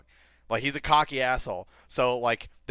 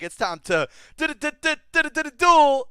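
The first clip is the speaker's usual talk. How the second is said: high, loud sung-out catchphrase; hammed-up duel call; 14 semitones higher, 8 dB louder than usual. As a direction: belt out the anime duel cry. Yugi catchphrase retold.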